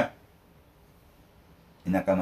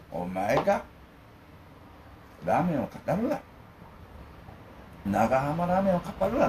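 mock scolding; 6 semitones higher, 4 dB quieter than usual. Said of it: "って言いたいんですよね".

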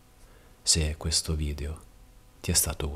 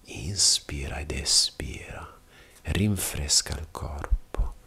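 calm yoga instructor guiding breath, with long pauses; measured bedtime breathing guidance, unhurried pauses between phrases; guided meditation; 3 dB quieter than usual. Real, not acted. Yoga teacher preparing listener for restful sleep, guided meditation.

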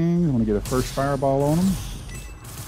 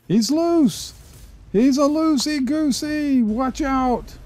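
excited; 13 semitones higher, slower than usual. Real, not acted. Excited gamer calling out.

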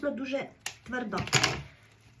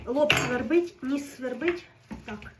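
normal talking voice

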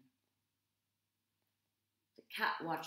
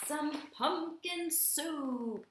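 savoring; 8 semitones higher, 5 dB louder, much slower than usual. A narrator's reading. Savoring the soup.

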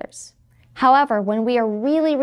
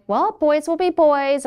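excusing teacher; 6 semitones higher, 3 dB louder, slower than usual. Retold or imitated. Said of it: "You may hear things like".

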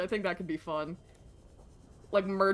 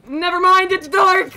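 very loud, high-pitched yell; alarmed shout; 9 semitones higher, 15 dB louder than usual. Real, not acted. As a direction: cry out in sudden alarm. Sudden darkness in the game.

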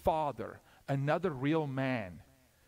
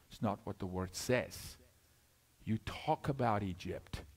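ordinary delivery

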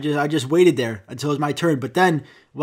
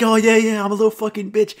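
high, eager dog agreeing; excited higher-pitched pet voice; happy dog voice; 7 semitones higher, 6 dB louder than usual. Acted. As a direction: play a delighted, clueless dog. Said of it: "And the dog's like".

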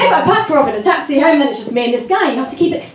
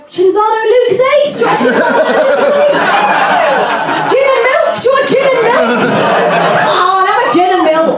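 offering drinks; 8 semitones higher than usual. Retold or imitated. Pushing odd drinks on guy.

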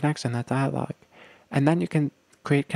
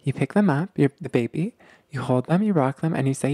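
ordinary delivery

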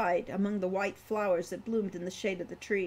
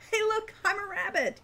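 much higher, giddy; playful girl; 12 semitones higher, 4 dB louder than usual. A narrator's reading. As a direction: tease like a bouncing child.

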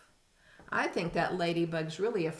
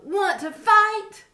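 high, slow squeaky dare; grouchy ladybug; 12 dB louder, slower than usual. A narrator's reading. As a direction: challenge them in a tiny high voice. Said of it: "said the grouchy ladybug".

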